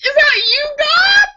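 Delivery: extremely high, loud squeal; shouting to God. Acted. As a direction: yell up to the sky, hamming it up. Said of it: "That's a funny one".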